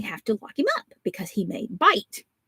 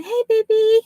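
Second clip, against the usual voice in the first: much higher cooing hello; baby talk; 14 semitones higher, 6 dB louder than usual. Retold or imitated. Grandmother greeting the baby on FaceTime, high cooing. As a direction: coo at the baby like a doting grandma. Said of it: "I'm going".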